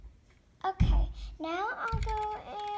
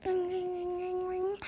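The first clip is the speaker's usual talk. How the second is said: steady mock phone trill; imitated phone ring. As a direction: run the rings together like a ringtone.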